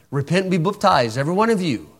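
lightly mimicked Southern preacher accent on baptized, said as "buptized"; mocking regional accent. Preacher mimics opponents quoting Acts in their accent. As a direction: poke fun at their accent, quoting the verse. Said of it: "they're gonna quote it like this".

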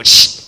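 sharp loud shushing to chase a dog. Retold or imitated. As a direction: hiss the dog out of the church. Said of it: "That's how they yell at dogs".

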